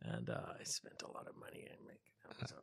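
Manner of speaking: whispered aside; whispering. Acted. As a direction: whisper it like a secret. Secret money confession.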